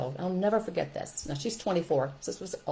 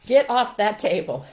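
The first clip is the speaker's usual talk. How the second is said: loud, higher scolding; stern command; 4 semitones higher, 8 dB louder than usual. Acted. Teacher ordering a child off the table, stern.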